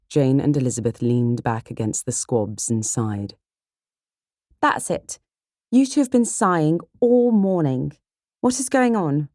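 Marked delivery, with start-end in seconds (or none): 4.6-9.3 high, loud outburst from an impatient sister; fed-up, high-pitched exclamation; exasperated cry. Acted. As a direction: burst out, demanding an answer. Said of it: "cried Kitty".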